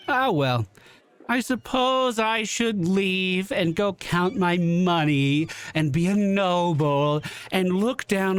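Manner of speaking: high, haughty noblewoman, lightly voiced; Camellia voice. Player voices Camellia character.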